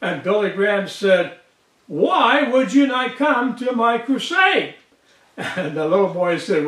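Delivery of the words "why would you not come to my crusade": higher-pitched, mock-baffled retelling; retold disbelief, pitched up; incredulous question. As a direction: act out a puzzled preacher wanting an answer. Retold preacher questions refusing boy.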